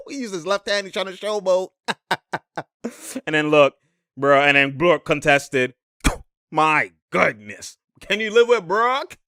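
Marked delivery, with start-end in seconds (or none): none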